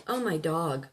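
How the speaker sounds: ordinary talk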